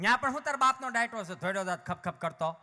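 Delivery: loud, fast rustic rebuke; village parent scolding. Retold or imitated. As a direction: bark it like a rough Kathiawadi father. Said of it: "આવો અમારા ગામડામાં". (કાઠિયાવાડી બાપની જોરદાર, ઝડપી ધમકી)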